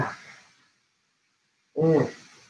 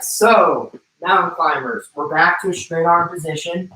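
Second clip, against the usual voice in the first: ordinary delivery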